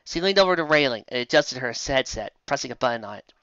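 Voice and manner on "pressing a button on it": plain reading voice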